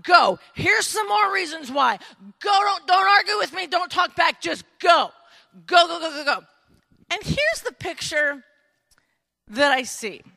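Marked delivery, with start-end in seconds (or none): 0.0-6.4 loud, insistent push to leave; emphatic urging. Acted. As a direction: urge them off forcefully, no room for argument. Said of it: "emphatically telling them and trying to convince".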